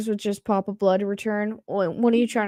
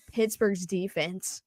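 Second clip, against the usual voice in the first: ordinary talk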